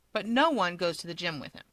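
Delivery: ordinary delivery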